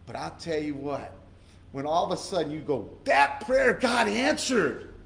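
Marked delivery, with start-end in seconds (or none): 3.0-4.9 high, loud, slow cry of joy; joyful exclamation. Retold or imitated. Preacher voicing a believer's answered prayer, joyful shout.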